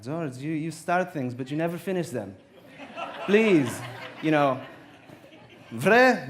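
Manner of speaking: put-on God scolding George in a mild Greek-dad accent. God telling George he never finishes, in a Greek accent. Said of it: "like, a Greek accent".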